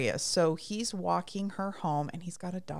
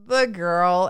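much louder, decisive quoted reply; emphatic acted answer; 10 dB louder than usual. Retelling Nick answering Gus about the girl, emphatic answer. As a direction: come down hard on both words.

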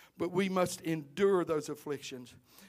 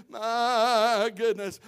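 ordinary talk, nothing marked

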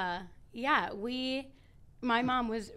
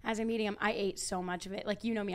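ordinary delivery

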